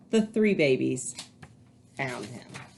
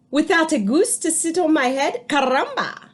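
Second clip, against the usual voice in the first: high, louder hen voice; a fed-up hen; chicken voice; 8 semitones higher, 3 dB louder than usual. A narrator's reading. Chicken complains about the goose, high. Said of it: "cried the chicken".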